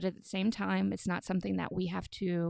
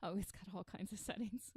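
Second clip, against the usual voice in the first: ordinary talk, nothing marked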